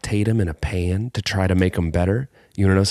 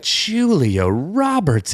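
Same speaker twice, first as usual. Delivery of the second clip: drawn-out, higher mock exclamation; exclamation as curse; 9 semitones higher, much slower than usual. Retelling getting out into the cold, drawn-out mock oath. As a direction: stretch the name out like an oath. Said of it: "I got out of the car and I was like".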